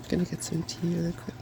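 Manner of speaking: plain reading voice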